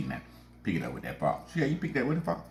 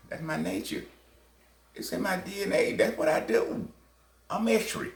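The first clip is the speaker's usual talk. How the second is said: high, sly put-on voice for Scorpio; Scorpio the scorpion; 10 semitones higher than usual. Retelling the Frog fable, the scorpion boasts, high and smug. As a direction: pitch it up high and smug, proud of his nature. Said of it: "Scorpio said".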